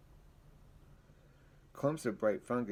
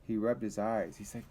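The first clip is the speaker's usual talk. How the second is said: usual speaking voice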